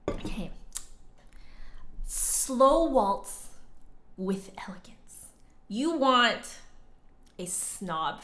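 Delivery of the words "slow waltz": normal talking voice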